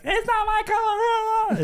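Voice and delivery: very high, drawn-out mock wail; hammed-up childish tantrum whine; crying kid. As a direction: imitate a kid crying over a toy. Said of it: "his kid's crying".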